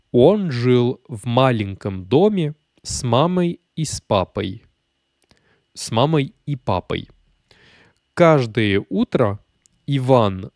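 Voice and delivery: russe très lent, syllabes étirées; lecture lente. Shown as a demonstration. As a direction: lis lentement pour qu'on puisse répéter, avec des pauses. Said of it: "Je vais le lire lentement". (lecture lente et articulée pour faire répéter)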